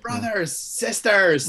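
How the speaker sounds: put-on high, rallying cry in an Irish accent; Magneto. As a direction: imitate an Irish-accented yell. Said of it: "it comes out the most when he's yelling".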